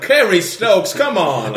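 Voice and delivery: high, loud teasing imitation of the billionaire; mock Kerry Stokes. Mimicking Kerry Stokes calling out to the hosts. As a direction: call it out like the man himself, amused. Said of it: "was like".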